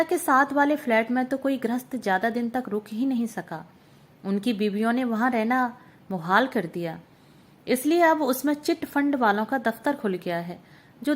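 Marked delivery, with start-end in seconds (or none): none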